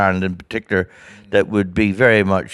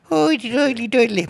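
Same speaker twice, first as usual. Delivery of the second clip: very high, singsong Southern Irish caricature; mock a Southern Irish lilt, pitched way up; silly high Southern Irish accent. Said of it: "a southern doity doily accent".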